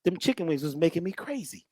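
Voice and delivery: hushed, unvoiced confession; whisper. Real, not acted. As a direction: lean in and whisper it.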